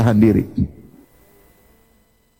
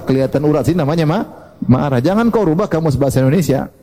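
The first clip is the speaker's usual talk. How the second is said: ordinary talk, nothing marked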